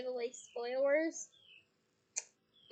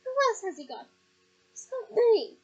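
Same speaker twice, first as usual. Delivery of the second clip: loud, higher, heated retort; heated argument; 7 semitones higher, 12 dB louder than usual. Acted. Mother arguing with Sirius at the table, loud and heated. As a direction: hit back hard in the argument.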